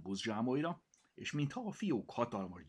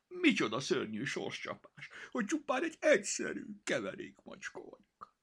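mock tragic lament; 9 semitones higher than usual. Cat's mock tragic complaint.

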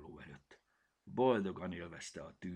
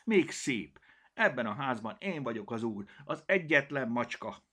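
louder, higher proud boast; retort; 6 semitones higher, 7 dB louder than usual. Acted. Cirmi boasting to the kitten, proud. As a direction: snap back, lord of the house. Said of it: "vágta rá Cirmi".

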